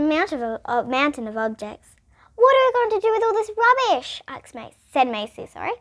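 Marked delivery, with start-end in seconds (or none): 2.3-4.2 curious sister. Acted. Sister's high quizzical voice.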